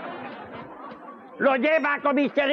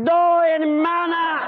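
drawn-out comic announcement; 4 semitones higher, 3 dB louder, slower than usual. Acted. Comic announcing a new joke.

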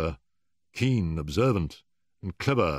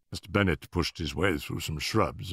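usual speaking voice

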